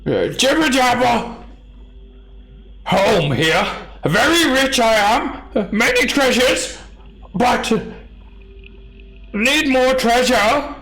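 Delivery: very high, loud, broken-speech greedy giant. Acted. Two-headed giant asking the party for shiny treasure. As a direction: bargain like a simple-minded brute hungry for treasure.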